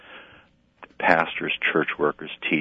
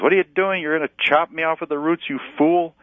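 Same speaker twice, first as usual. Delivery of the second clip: much higher, louder, fast frantic shriek; panicked screaming vine; 12 semitones higher, 5 dB louder than usual. Acted. Vine screaming at the gardener with the shovel, frantic. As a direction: shriek in panic as the roots are dug around. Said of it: "the, the vine screams".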